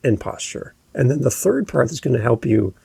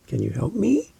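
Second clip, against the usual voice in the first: higher, softer question, sweeping up on "me"; upspeak; 4 semitones higher, 4 dB quieter than usual. Shown as a demonstration. Coach demonstrating a real question, pitch going up on "me". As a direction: lift "me" sharply at the end. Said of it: "I went up".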